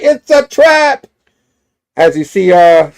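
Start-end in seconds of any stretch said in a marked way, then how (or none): 0.0-1.1 high, drawn-out shout of warning; warning cry. Acted. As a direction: yell it out like a superhero springing the alarm. Spidey's warning, acted out.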